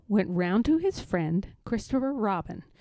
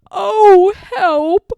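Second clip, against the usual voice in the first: very loud, high yelp; falling bear shouts for help; 11 semitones higher, 17 dB louder than usual. A narrator's reading. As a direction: cry out as you hit the bush. Landing in gorse bush.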